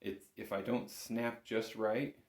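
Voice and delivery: ordinary delivery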